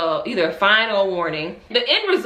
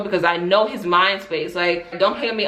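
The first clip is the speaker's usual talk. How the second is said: ordinary talk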